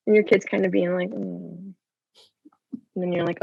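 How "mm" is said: put-on unenthusiastic kid.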